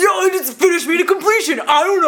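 douchey guy voice. Retold or imitated. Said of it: "douchey guy voices".

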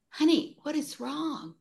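lightly voiced concerned husband. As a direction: imitate a puzzled, concerned spouse. Retold concerned husband's question.

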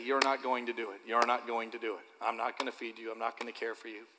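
put-on low, soft, fading line for the girl to repeat; coached quiet refusal. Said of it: "I need you to say".

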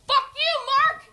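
high yelled insult out the window; mock shout. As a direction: yell it at nobody, hammed up. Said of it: "he just goes".

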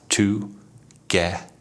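"together" slowly broken into to, ge, ther with gaps; syllable by syllable. Shown as a demonstration. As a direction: split "to-ge-ther" into three separate beats. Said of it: "together has three syllables".

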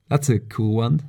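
usual speaking voice

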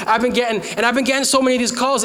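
ordinary talk, nothing marked

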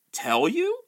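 high, rising incredulous question; shrill, affronted witch's retort; suddenly shriller. A narrator's reading. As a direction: pitch leaps up in an indignant echo. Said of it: "her voice growing suddenly shriller".

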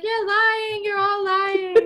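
high, drawn-out mock protest; accusing protest. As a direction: imitate a boy wailing his accusation in a high voice. Accused boy protesting in retold scene.

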